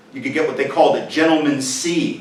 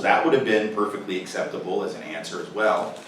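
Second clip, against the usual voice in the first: normal talking voice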